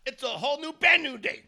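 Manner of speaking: high, loud joyful cry; excited shout. Real, not acted. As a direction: shout it to the rafters. Celebrating the signing.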